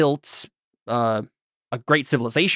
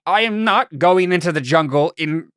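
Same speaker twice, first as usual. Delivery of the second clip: tipsy protest, a bit louder and higher; lightly played drunken refusal; sort of drunk; 4 semitones higher, 3 dB louder than usual. [name] refusing to enter the night jungle, sort of drunkenly. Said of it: "sort of drunkenly".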